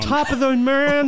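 high, loud mangled Irish; mocking bad Irish. Co-host teases her failed imitation, loud botched Irish. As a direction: belt out a terrible Irish greeting. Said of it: "Please do more Irish imitations".

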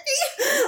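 a burst of very high giggling; high giggle. Real, not acted. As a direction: let a squeaky laugh burst out. Laughing at her own story.

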